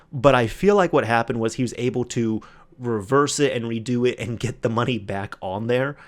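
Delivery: ordinary talk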